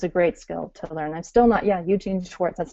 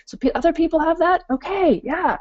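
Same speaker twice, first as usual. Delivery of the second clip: surprised customer; 10 semitones higher than usual. Retold or imitated. Voicing customer's surprised reaction.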